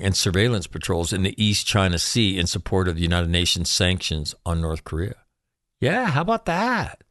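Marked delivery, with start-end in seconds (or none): 5.8-7.0 high, mocking how about that; mock amazement. Host mock marvelling at the UN patrols in a high voice.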